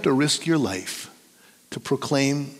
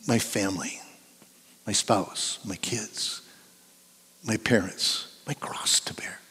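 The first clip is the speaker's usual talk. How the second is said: soft, low mock lament; trailing into a near-whisper; self-pitying complaint; 4 semitones lower, 9 dB quieter than usual. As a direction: list your burdens in a hushed, sorry-for-yourself voice.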